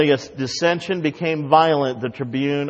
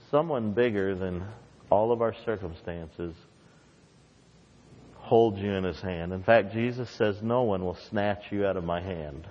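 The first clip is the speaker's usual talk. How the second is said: soft reassurance; 4 semitones lower, 6 dB quieter than usual. Acted. Tender closing reassurance.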